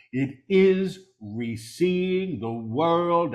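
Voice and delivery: deliberate full-voice repeat; measured proclamation. Real, not acted. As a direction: proclaim it clearly, word by word. Same words repeated in full voice.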